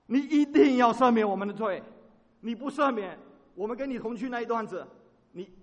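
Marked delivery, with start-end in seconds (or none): 0.1-4.5 loud cry for forgiveness; desperate plea. Acted. 摩西求神赦罪，大声迫切地呼求. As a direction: plead as if life depends on it.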